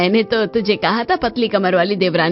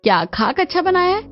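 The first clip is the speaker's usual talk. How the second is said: high, indignant rhetorical question; scornful retort. Acted. Mother-in-law dismissing praise of the cooking, scornful. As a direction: scoff with the pitch jumping up.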